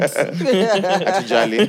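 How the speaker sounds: slow giggly words; laughing. Real, not acted. Laughing while speaking.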